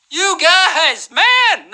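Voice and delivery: high, excited exclamation; thrilled game-show host outburst; impressed. Real, not acted. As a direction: cheer the winners with pitch soaring.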